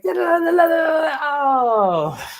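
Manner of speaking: high, loud mock da da da; mock impatient yelling. The impatient manifester shouting at the universe, high and loud. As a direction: ham up an impatient wait.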